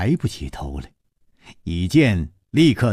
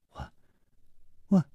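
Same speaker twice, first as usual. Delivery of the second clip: trembling voice; 8 semitones higher, 9 dB quieter than usual. Acted. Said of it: "颤声说".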